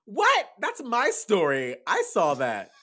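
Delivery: high loud shriek easing down; outraged disbelief. Acted. Friend hearing her own story stolen, outraged high cry. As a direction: burst out high and loud, then stretch the claim. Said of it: "and she's like".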